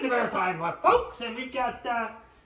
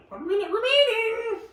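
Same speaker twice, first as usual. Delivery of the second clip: much higher and louder, a mock countdown call; mock announcer; 13 semitones higher, 5 dB louder than usual. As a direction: announce the final minute like a game-show host. Countdown before the broadcast ends.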